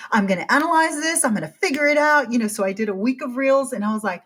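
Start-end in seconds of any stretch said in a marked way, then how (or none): 0.1-2.3 higher, louder eager vow; nerdy resolve. Retold or imitated. Her inner nerd taking on Instagram, eager and loud. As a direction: gear up like a scientist on a mission. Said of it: "this is my nerd coming out in me".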